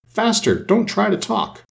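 high-pitched queen urging haste; urgent crying out. A narrator's reading. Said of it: "for she cried".

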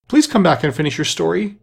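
high, entreating call; calling after. A narrator's reading. Alice calls to departing mouse, entreating. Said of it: "Alice called after it".

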